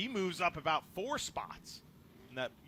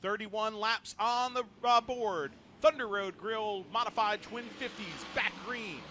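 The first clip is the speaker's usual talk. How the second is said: raised, louder race call; excited play-by-play; 5 semitones higher, 4 dB louder than usual. Real, not acted. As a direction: call the green flag with energy. Live race call on restart.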